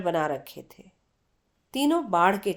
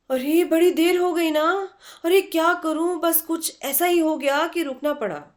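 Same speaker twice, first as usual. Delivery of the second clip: higher, louder hurried excuse; flustered muttering; 7 semitones higher, 4 dB louder than usual. A narrator's reading. Chitra rushes in late before her train, flustered and loud. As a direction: bustle in apologizing for being late. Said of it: "बड़बड़ाती सी चित्रा ने प्रवेश किया".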